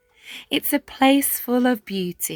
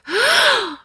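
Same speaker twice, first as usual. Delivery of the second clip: sharp high gasp of shock; a class gasping in surprise; 5 dB louder than usual. A narrator's reading. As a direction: suck in air, startled, high. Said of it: "the students all gasp".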